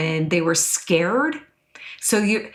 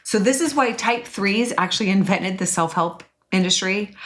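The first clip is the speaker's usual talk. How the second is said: ordinary delivery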